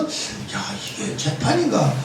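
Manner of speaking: very soft murmur to himself; baffled muttering. Retold or imitated. Defendant wondering if this is really a trial, baffled murmur. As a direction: let it out under your breath, bemused. His thought during the trial.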